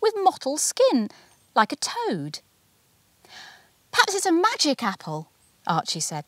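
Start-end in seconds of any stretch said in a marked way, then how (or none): 0.0-2.4 daddy marvelling. A narrator's reading. Daddy voiced in story. 3.9-5.3 put-on a small boy dreaming of magic; wondering boy. A narrator's reading. Archie to his daddy, high and wondering. Child Archie voiced in story.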